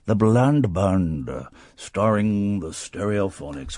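slow, low trailer announcer; movie trailer voice. Pretend. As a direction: boom it like a film trailer.

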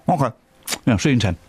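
talking round a pretend sweet; mock sucking a sweet while talking; pretending to eat a sweet. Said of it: "Were you eating a sweet?".

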